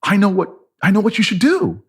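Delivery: high-pitched, awestruck realisation; as if struck by sudden divine insight; gasping revelation. Retold or imitated.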